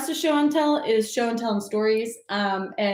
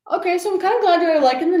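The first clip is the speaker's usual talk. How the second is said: bright exclaim; 9 semitones higher, 6 dB louder, faster than usual. Real, not acted. Reacting to comments.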